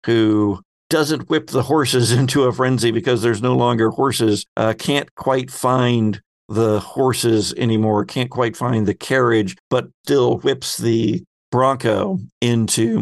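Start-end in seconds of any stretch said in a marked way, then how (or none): none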